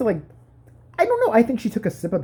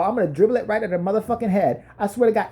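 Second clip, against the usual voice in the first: ordinary talk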